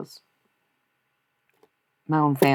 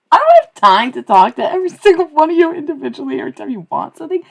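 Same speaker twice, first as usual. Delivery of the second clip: very high, loud rant at the chat; high, loud, exasperated tirade; shrieked outburst; 14 semitones higher, 10 dB louder than usual. Pretend. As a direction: blow up at them in a high-pitched yell. Mock outburst at chat, apologizes after.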